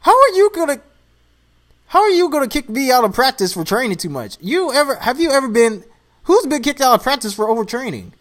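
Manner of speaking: indignant. Real, not acted. Indignant about being kicked out.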